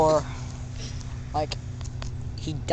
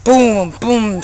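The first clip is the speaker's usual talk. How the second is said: punchy mouth-made gun sounds; gunshot noises; 3 semitones higher, 6 dB louder than usual. Acted. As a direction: blast out booms like a kid playing shooter. Imitating gunfire in game.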